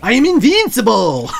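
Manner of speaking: loud hammed-up villain cry; Boris Grishenko. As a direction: shout the gleeful catchphrase. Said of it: "you're Boris Grishenko".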